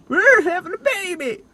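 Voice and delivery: high, loud mock announcement, slow; hammed-up joyful baby news; mock excited.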